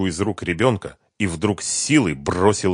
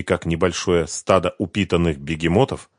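plain reading voice

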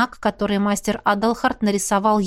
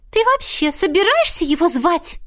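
indignant; 7 semitones higher than usual. Acted. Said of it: "возмутилась Мисси".

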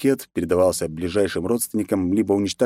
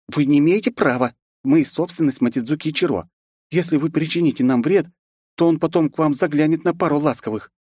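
angrily; 7 semitones higher than usual. A narrator's reading. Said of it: "злобно произнесла Роузи".

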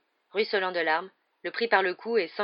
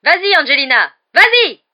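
loud, rising, much higher cheer; excited cheering; 10 semitones higher, 17 dB louder than usual. Acted. Commentator cheering the chaser toward the goal, loud and high. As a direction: urge her on at the top of your voice.

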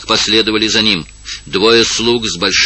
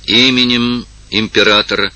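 loud reading; 4 semitones higher, 4 dB louder than usual. A narrator's reading. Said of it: "читал палач громким голосом".

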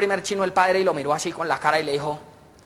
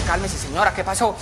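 ordinary talk, nothing marked

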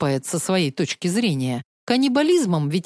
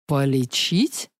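much lower, slow and doubtful; unsure dragon; 6 semitones lower, slower than usual. Acted. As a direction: ask in disbelief, deep and hesitant. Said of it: "неуверенно ... уточнил ящер".

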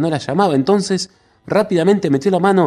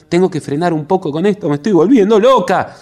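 fast, rising to a louder, higher shout; frantic shout; 5 semitones higher, 4 dB louder than usual. A narrator's reading. Margarita losing control on the train, frantic shout. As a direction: spiral into panic. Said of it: "gritaba Margarita".

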